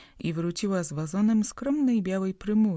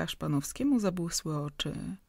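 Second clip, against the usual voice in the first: usual speaking voice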